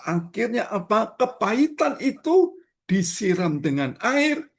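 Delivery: high, loud preacher's cry to the congregation; fiery preaching. Acted. Pastor urges the congregation, preaching at full force. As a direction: lift the voice and preach it hard.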